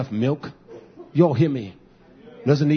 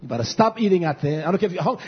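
ordinary talk, nothing marked

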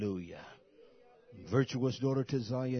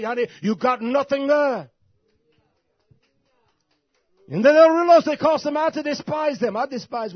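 much higher and much louder, shouting hellfire; full-force preaching; 11 semitones higher, 17 dB louder than usual. Acted. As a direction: preach it with everything you've got.

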